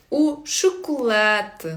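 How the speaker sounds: "У шоколад" slowly as oo shoo-koo-LET; slow European Portuguese "У шоколад"; smiling narrow vowel. Shown as a demonstration. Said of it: "як на посмішці".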